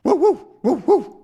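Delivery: high mock dog howl "woo". Dog Snowy howling high at the captain.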